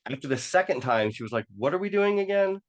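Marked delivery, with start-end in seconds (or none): 1.6-2.6 high-pitched puzzled challenge; puzzled passenger's questioning, acted out; mock incredulous question. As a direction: ask it high, imitating a baffled coworker. Coworker questioning repeated U-turn.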